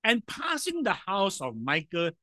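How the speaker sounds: normal talking voice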